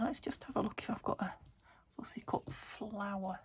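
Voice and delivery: quick whisper to herself. Real, not acted. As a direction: whisper it while searching. Muttering to herself while searching.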